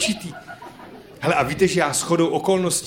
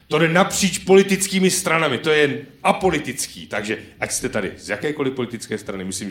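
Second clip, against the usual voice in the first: usual speaking voice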